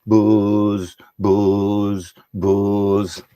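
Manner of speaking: put-on low, drawn-out Birmingham-accented buzz; Brummie bee buzz. Poet showing how Birmingham bumblebees go, low Brummie buzzing. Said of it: "come from Birmingham 'cause they go".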